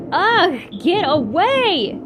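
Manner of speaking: screaming at a licking dog, loud and high. A narrator's reading. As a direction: shriek at the dog. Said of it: "Jessie screamed".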